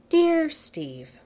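high, sing-song formal greeting; grand address. Acted. Tony greeting Steve with mock formality. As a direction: address him with mock ceremony.

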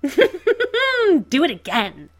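manic laugh into a loud, high, slow plea. A narrator's reading. Joker after Bruce punches him, manic laughing demand. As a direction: cackle and beg for another hit.

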